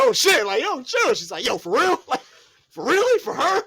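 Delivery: high, loud disbelief; mock outrage. Bianca reacting to the betrayal, in high mock disbelief. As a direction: squeal it in hammed-up outrage.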